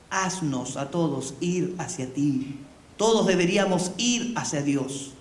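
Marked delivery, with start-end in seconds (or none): 0.1-2.6 slow, lower, prayerful plea. Acted. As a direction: ask it slowly like a quiet prayer. Petición rezada a Jesús. (súplica lenta y grave, como oración)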